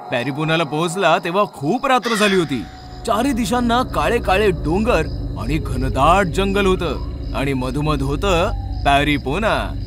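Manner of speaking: plain reading voice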